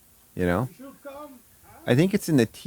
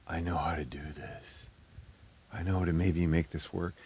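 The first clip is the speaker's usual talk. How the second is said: whisper; 6 semitones lower, 13 dB quieter than usual. Retold or imitated. Retelling a late-night thought.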